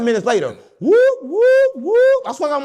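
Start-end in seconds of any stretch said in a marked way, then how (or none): 0.8-2.2 high loud mock police siren whoop; siren imitation. Imitating the police siren as they get pulled over.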